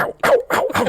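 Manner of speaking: mock yelps. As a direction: yelp like you're getting shot. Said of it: "you hear him go".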